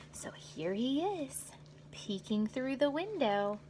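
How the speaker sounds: soft, slow aside about the picture; quiet picture-book aside; hushed aside. Real, not acted. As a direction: point at the page and hush. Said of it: "aside showing pictures".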